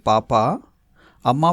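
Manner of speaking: usual speaking voice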